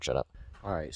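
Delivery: ordinary talk, nothing marked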